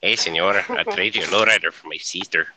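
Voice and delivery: loud cartoon Mexican accent. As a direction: bark it like a cartoon Mexican bandit. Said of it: "a Mexican accent".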